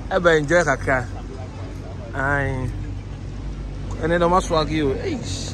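loud, high, over the music; hype man shouting. Real, not acted. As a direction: pump up the crowd at the branch.

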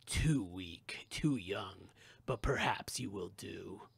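low, heavy, cold voice from the pit; ancient cold voice. Acted. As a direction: speak like something ancient and evil. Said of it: "The voice felt ancient, cold, and heavy".

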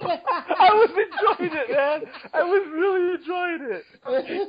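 high, slow words through laughter; laughing. Real, not acted. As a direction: talk while cracking up. Laughing at the old voice.

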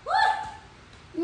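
high excited whoop. Real, not acted. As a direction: cheer the class into the challenge. Instructor cheering class on.

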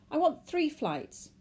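high, slow, loud demand; emphatic, urgent order. A narrator's reading. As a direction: state it insistently to the ticket clerk. Man demands tickets at desk.

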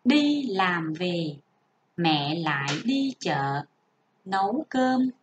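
careful classroom reading; model reading aloud. Shown as a demonstration. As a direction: read it as an example to copy. Teacher models reading paragraph two.

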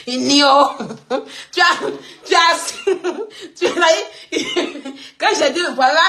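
too tickled to finish the sentence; words broken up by real giggles; helpless laughter. Real, not acted. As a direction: try to speak, keep collapsing into laughter. Helpless laughter interrupts retelling.

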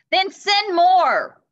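very high, loud and slow command; thundering. Acted. Christmas Knight ordering King Arthur, thundering. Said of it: "thundered the Christmas Knight".

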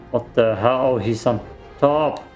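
usual speaking voice

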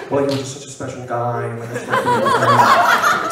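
giggly gushing. Pretend. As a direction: gush and crack up laughing. Mock Timothée gushing again.

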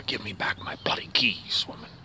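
a yell turned into a hushed whisper; whispered demand to the wife for the keys; whispered mock yell. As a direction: hiss the demand under your breath. The yell he wants to give.

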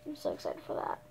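dry, whispered sarcasm; sarcastic whisper. Real, not acted. Kid grumbling about cleaning her room, whispered sarcasm. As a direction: whisper it like you dread the chore.

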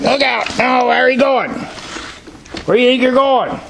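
high-pitched teasing scold; scolding the goats. Real, not acted. Farmer chiding a goat that slips away, high-pitched scold. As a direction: chide the runaway goat as it wanders off.

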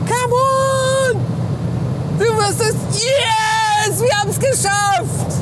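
sideline yelling. Real, not acted. Cheering, then reaching top speed.

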